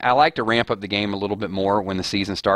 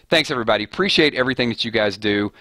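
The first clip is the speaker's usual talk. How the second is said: usual speaking voice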